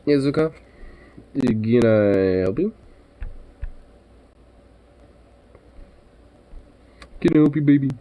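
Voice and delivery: high, sweet pet talk. Real, not acted. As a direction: coo at the puppy.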